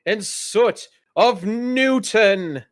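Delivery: high, slow fight-night announcing; dwarf announcer introducing Bao Lee; ring announcer intro. Acted. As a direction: drag out the fighter's name for the crowd.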